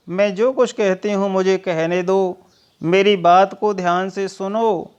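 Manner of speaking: usual speaking voice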